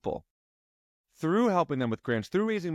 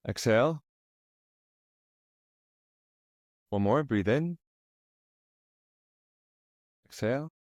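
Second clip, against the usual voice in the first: lower, calm, unhurried guiding voice; guided meditation; 4 semitones lower than usual. Acted. Coach guiding client through breathing, calm meditation voice.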